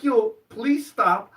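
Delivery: high, loud, slow plea; exasperated retold begging; mock pleading. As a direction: drag out a hammed-up appeal to stop. Pleads with girl in story.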